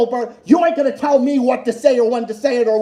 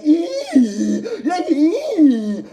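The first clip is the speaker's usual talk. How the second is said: mock squeal; 4 semitones higher, much slower than usual. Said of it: "you sit here like".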